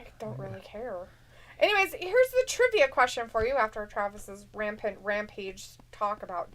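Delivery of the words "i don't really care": breathy whisper, brushing it off; whispered aside. Real, not acted. Host whispers a dismissive aside to co-host. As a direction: whisper it under your breath.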